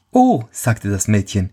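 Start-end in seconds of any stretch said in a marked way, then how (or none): 0.1-0.4 put-on girl's exclamation. Girl exclaims.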